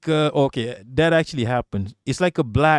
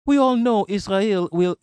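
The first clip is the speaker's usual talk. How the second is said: put-on higher-pitched, failed British accent; 6 semitones higher than usual. Streamer reading a comment in a shaky attempt at British accent. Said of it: "I can't do Limey accent".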